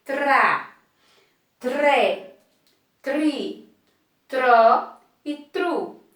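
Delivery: slow "tra, tré, tri, tró e tru", a pause after each; syllable drill. Shown as a demonstration. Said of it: "eu vou ler". ("tra, tré, tri, tró e tru" devagar, sílaba por sílaba)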